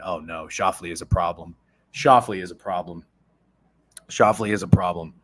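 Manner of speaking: ordinary delivery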